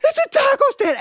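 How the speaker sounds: put-on high, loud wail of a crushed nine-year-old; sobbing kid. Brother wailing to the family at the taco stand, sobbing. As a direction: sob it out in disbelief. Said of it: "he just starts sobbing".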